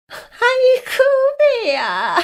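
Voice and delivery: hammed-up belly laugh that sounds like sobbing; mock howl of laughter; exaggerated wailing laugh. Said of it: "과장되게 꺼이꺼이 울듯이 웃는".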